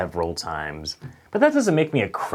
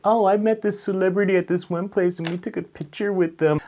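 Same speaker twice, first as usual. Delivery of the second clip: much higher, gushing voice; bragging friend; 9 semitones higher than usual. Retold or imitated. Friend bragging about meeting a celebrity, gushing. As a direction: show off a celebrity photo. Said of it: "anytime someone's like".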